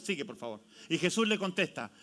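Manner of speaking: ordinary talk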